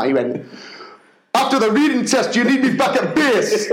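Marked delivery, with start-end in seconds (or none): 1.3-3.7 high, put-on military report; army-style PE teacher. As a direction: report for duty like a soldier, mock serious. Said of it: "stood there like he was in the".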